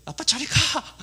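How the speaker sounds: high-pitched mock child's protest; child's angry outburst. Cranky son shoving dad away at bedtime, mock angry high yelp. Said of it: "얘가 화풀이를 이제 하는 거예요".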